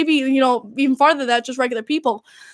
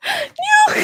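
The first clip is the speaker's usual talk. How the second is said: real dismayed cry. Real, not acted. Reacting to game on screen.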